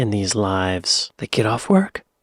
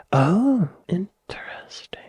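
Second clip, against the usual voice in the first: whispered interest; 8 semitones higher, slower than usual. Real, not acted. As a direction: murmur it under your breath, intrigued. Whispered reaction to synopsis.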